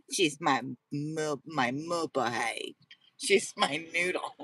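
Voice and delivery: "moo-ba-hay" and "noodle" stretched slowly; slow pet talk. Shown as a demonstration. Owner cooing nicknames for her dog, slow and fond. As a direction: croon the silly nicknames. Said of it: "That's how I say it".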